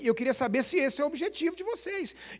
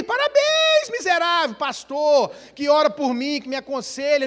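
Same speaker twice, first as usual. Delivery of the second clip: high, loud sarcastic outburst; sarcastic mock congratulation; 3 semitones higher, 5 dB louder than usual. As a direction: fling it out bitterly at the church. Ironic mock thanks, shouted. (parabéns sarcástico, agudo e alto)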